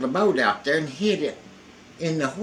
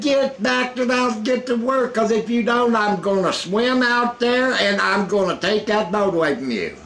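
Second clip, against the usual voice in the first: high, loud bossy threat; imitating Rusty threatening; 10 semitones higher, 3 dB louder than usual. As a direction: order him back to work.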